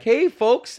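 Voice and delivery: chirpy fake-nice cop; mock cop greeting. Cops greeting the comedy crowd, mock friendly. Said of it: "they're like".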